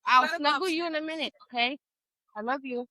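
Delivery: soft parental reassurance. Real, not acted. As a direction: gently send a little one off, quietly. Interrupts story to soothe a child.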